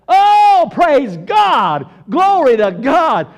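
loud, exultant church outburst; shouted praise. Acted.

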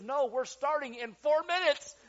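high, very loud urgent warning; acted-out frantic stage manager yell; retold panicked shout. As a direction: shout it like airtime is about to hit. Retold panic before live show.

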